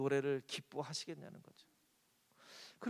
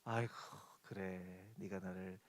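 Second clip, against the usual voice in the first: low, soft, fond acceptance; like a doting parent, softly; God gently accepting a child's praise; tender fond voice; 9 semitones lower, 12 dB quieter than usual. Pretend.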